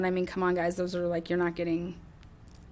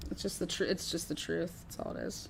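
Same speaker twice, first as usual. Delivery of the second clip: fading to a hush; 3 semitones lower, 9 dB quieter than usual. Real, not acted. Fades to near whisper.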